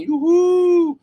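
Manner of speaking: high, loud mock cheer; retold cheering scream. Imitating cheering cinema audience, loud and high. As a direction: whoop it up like an audience at the end. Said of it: "and screaming".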